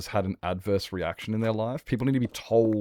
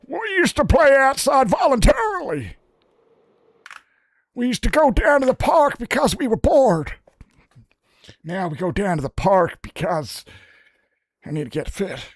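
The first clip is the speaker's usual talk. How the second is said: put-on much higher and louder old man with an odd accent; old guy with an accent; 8 dB louder than usual. Old man lecturing Nevada about playing outside, put-on accent. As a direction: grumble it like an old timer telling kids off. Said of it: "the old guy had an accent too".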